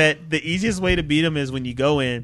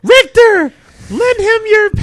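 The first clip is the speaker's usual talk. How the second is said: very high, loud theatrical cry; wailing mock-heroic summons; melodramatic game character; 9 dB louder than usual. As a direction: call out a hammed-up plea. Acts out game's dramatic line.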